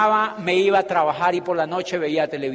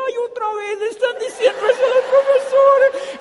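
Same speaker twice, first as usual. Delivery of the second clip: high, loud mock-weary exclamation with a laugh; high laughing scoff at the dean; mocking sigh; 6 dB louder than usual. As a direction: roll your eyes at the same old pitch, laughing. Mocking the dean's repeated promise.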